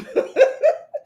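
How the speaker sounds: airy high-pitched giggle; breathy high laugh. Real, not acted. Host cracking up reading the chat.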